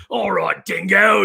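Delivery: hammed-up Aussie cartoon voice; mock a broad Australian accent; exaggerated Australian accent. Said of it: "he was Australian in Pride of the X-Men".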